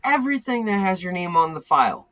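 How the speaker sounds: high, quick, emphatic orders; imitated instructing clerk. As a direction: imitate an insistent clerk sending someone off on an errand. Acted office worker giving orders.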